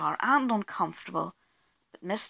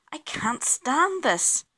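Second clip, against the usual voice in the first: higher, slow, drawn-out complaint; exasperated bear; 7 semitones higher, slower than usual. A narrator's reading. Mister Bear kept awake by snoring, exasperated. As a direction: stretch out the words in weary protest.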